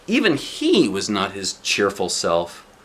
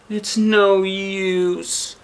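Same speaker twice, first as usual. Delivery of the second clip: very slow, weary sigh; 8 semitones higher, much slower than usual. Acted. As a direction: let the words sink with despair. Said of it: "she sighed".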